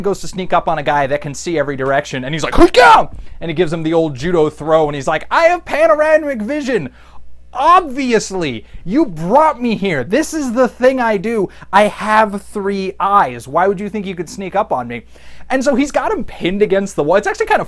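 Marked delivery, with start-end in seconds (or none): 2.5-3.1 high loud attack cry; hammed-up lunge shout; mock battle yell. Beastman sneaking up then yelling his attack. 5.3-15.0 lightly voiced cocky Triclops; smug hero voice. Triclops lecturing the pinned gorilla smugly.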